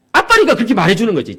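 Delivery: a son insisting the class voted him uglier; upset teen son. Retold or imitated. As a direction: argue back like a hurt teen. Son's tearful reply voiced.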